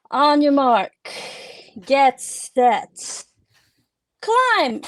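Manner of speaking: loud, drawn-out starting call, rising to a high final word; whistle-blower's call. Acted. Staff member starting the climbing race, loud announcing call. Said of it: "staff member who's about to blow the whistle say".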